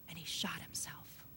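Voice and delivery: low, near-silent whisper of a shocking fact; hushed whisper. Retold or imitated. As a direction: barely breathe the words out. Telling the suicide in the story.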